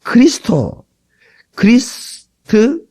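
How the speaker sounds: 크리스토 then 크리스트, slow and deliberate; 크리스트를 천천히 또박또박; spelled-out Christ. Shown as a demonstration. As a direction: sound out 크리스트 syllable by syllable. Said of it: "크리스마스라는 말은".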